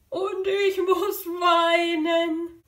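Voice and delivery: high, drawn-out pretend wail; mock crying. Animal saying it must cry, hammed-up tearful wail. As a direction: wail it slowly like a playful sob.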